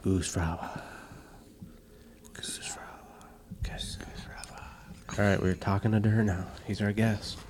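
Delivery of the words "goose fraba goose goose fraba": slow drawn-out whisper. Shown as a demonstration. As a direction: breathe it slowly, no voice. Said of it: "repeats it whispered".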